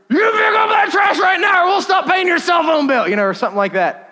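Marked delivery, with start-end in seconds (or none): 0.1-3.1 much higher, loud mock yell; parents yelling. Parents storming in on their son over the trash, hammed-up yell. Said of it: "They storm into the room".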